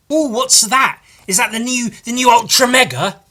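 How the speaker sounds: high, loud excited gasp; light up at a new find. Real, not acted. Spotting the comic, a high excited gasp.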